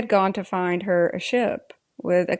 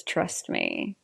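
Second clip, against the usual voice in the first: low, much softer sarcastic echo; mocking echo; 8 dB quieter than usual. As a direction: throw his words back coldly. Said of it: "There it is".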